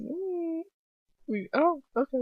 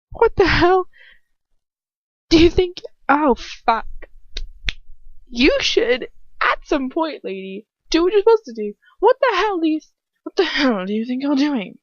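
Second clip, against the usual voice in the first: furious demand; 9 semitones higher, 4 dB louder than usual. Acted. Lucius bursting in angrily.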